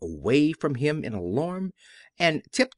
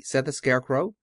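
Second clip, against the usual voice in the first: ordinary delivery, nothing marked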